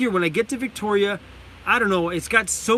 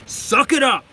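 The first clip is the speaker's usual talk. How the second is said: mock tough shout; 5 semitones higher, 4 dB louder than usual. Teasing the dudes about crying.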